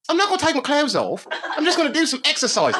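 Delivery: high voice, laughing through it; imitating the stripper. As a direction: mimic her prim announcement with a laugh. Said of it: "she says".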